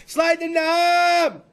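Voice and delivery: very drawn-out, high announcer holler; hyped catchphrase shout. Acted. Host throwing to a commercial break, hollered announcer style. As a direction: stretch the last word into a long shout. Host's catchphrase closing the segment.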